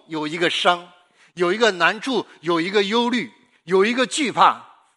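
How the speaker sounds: rising, fervent sermon appeal; impassioned preacher urging the hearers; preaching. Acted. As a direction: press each need home to the listeners.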